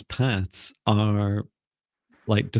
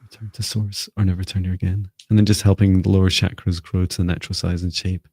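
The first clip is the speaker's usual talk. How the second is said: guided healing voice. Acted. Energy healing, guided session.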